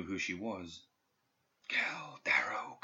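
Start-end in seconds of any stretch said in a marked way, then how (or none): 1.7-2.8 slow, breathless whisper from far off; ghostly whisper. A narrator's reading. Dead student's voice reaching Perdita's mind, ghostly whisper. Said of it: "she heard the whispering voice above her".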